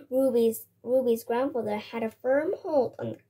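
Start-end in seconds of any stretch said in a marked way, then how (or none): none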